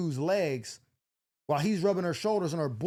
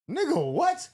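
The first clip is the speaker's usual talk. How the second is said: sudden high indignant what; shocked outburst; 11 semitones higher, 4 dB louder, slower than usual. Retold or imitated. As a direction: snap back in total disbelief. Boyfriend accuses him of jacking off.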